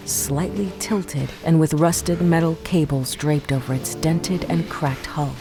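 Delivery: ordinary talk, nothing marked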